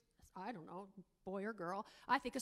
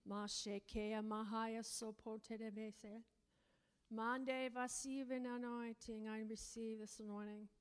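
softer, murmured prayer; quiet prayer in tongues; 4 semitones lower, 7 dB quieter than usual. Real, not acted. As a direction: pray under your breath in tongues. Praying in tongues softly.

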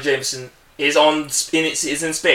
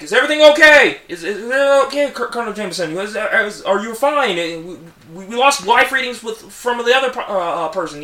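higher, worried crew voice; high anxious crew checking on Colonel Jameson; radio crew; 7 semitones higher than usual. Retold or imitated. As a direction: call up anxiously over radio. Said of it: "they're like".

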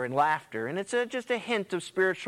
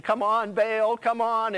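pleading call to Baal, higher and louder; hammed-up frantic prophets calling on Baal; mock yelling; 6 semitones higher, 4 dB louder, slower than usual. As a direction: beg the idol loudly, dragging it out. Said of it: "they're screaming and yelling".

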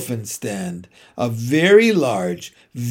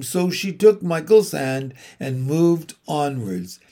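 usual speaking voice

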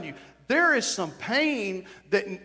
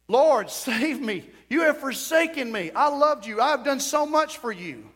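higher, pleading outcry to God; crying out; 5 semitones higher, 3 dB louder than usual. Acted. Preacher voicing our cry to the Lord, raised plea. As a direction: plead upward as if abandoned. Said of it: "to cry out".

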